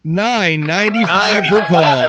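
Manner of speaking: loud, slow mock announcer. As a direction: boom the price like an ad. Repeats the disclaimer, exaggerated.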